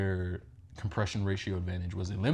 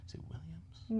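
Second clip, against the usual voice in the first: whispered; 10 semitones higher, 7 dB quieter than usual. Real, not acted. Unsure aside while listing teams.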